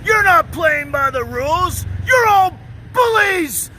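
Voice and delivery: high, loud, slow crybaby whine; mock a sore loser near tears; mock crybaby whine at the other players; whiny tantrum.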